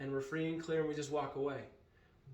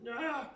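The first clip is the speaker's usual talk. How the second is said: frustrated cry; 10 semitones higher than usual. Retold or imitated. Mom delays, frustrated outburst.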